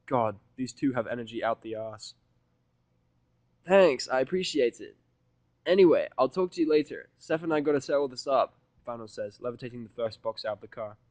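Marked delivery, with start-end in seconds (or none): 3.7-8.5 Vinyl's voice. Acted. Vinyl ending chat with Rainbow.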